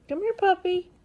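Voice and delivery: high, loud call to the puppy; calling the dog. Real, not acted. Calling the puppy over from the kitchen, high and loud. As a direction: sing out to the dog across the room.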